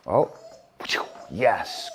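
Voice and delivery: ordinary delivery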